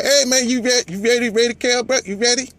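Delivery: high, loud mock Texas accent; Earl Spence. Challenger calling out Kell Brook, put-on Texas accent. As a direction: put on an eager high-pitched Texas twang. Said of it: "That's my Texas accent".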